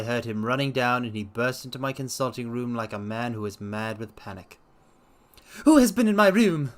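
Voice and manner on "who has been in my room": high, loud panicked cry; frantic cry of a scared old man. Acted. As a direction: shout it in a panic. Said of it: "he cried".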